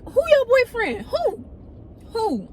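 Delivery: incredulous high-pitched who; disbelief. Retold or imitated. Narrator stunned by reveal.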